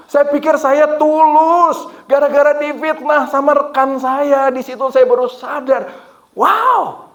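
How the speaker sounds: high, loud testimony with stretched key words; fervent full-force sermon climax; impassioned preaching. Real, not acted. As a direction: cry it out to the congregation, drawing words long.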